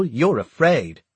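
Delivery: slow it down and stab it; angry accusation. A narrator's reading. Confronting Hawke in quarrel.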